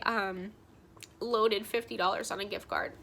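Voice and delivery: usual speaking voice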